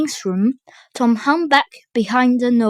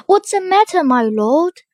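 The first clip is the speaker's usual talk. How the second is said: lightly voiced girl; 6 semitones higher, 4 dB louder than usual. A narrator's reading. Girl's voice in story.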